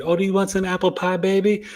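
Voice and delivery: high, quick, sugary mother offering pie; sweet mom voice. Retold or imitated. Mother sweetly offering her child apple pie, high and sugary. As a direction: coo it like a doting mom. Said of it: "mom could be so sweet".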